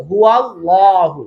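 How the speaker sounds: হুয়াল্লাহু slow, thick dark L; slow recited হুয়াল্লাহু; heavy L in Huwallahu. Shown as a demonstration. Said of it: "ওয়ার জবর help করেছে".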